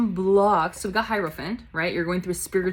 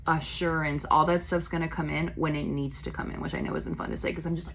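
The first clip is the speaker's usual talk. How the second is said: ordinary talk, nothing marked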